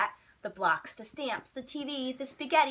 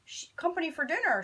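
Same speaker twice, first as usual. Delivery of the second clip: startled neighbour mumbling mid-bite; mumbling with mouthful; 7 semitones higher, 5 dB louder than usual. Acted. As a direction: mumble through a mouthful of meatballs, higher and a touch louder. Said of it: "she mumbled with a mouthful of meatballs".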